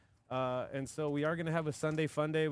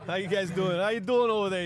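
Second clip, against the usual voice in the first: louder, higher New York tough-guy greeting; New York accent; 7 semitones higher, 9 dB louder than usual. Retold or imitated. Host mocking Connor's voice to co-host, loud New York accent. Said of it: "You heard that little accent".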